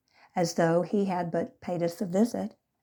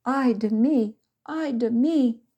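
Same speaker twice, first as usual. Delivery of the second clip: high, loud whining lament; whined; 7 semitones higher, 6 dB louder than usual. A narrator's reading. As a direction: whine like you're near death. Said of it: "Maria whined".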